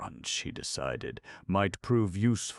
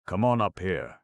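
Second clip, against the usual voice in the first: louder gruff call upward; 4 semitones higher, 6 dB louder than usual. A narrator's reading. Stone man calling Snorer up, gruff. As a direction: call them up, gruff and loud. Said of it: "he called gruffly to Snorer".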